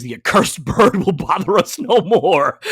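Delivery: comic-book bad guy proclaiming, slow and grand; hammed-up villain. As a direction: ham up a pulp villain vowing revenge. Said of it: "Chang pulls out his gun and says".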